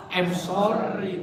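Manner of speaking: slow, drawn-out sorry; very slow mock apology. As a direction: stretch out a sheepish sorry. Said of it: "Kita kalau salah berkata".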